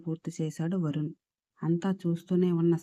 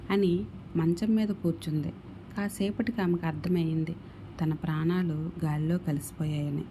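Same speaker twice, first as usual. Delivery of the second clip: ordinary delivery, nothing marked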